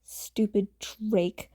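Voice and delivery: usual speaking voice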